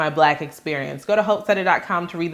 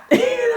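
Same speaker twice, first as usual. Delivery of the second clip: short burst of laughter. Real, not acted. Laughing at her own story.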